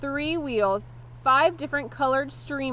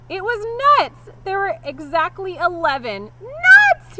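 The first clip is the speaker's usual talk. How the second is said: much higher and louder, slowed scream; 9 semitones higher, 9 dB louder than usual. A narrator's reading. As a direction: shriek in outrage at the nuts. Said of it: "he screamed".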